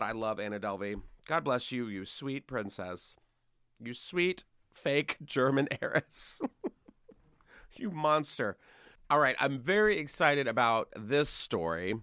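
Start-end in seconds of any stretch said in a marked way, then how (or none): 0.0-8.6 mock tender. Real, not acted. Affectionate mock endearment.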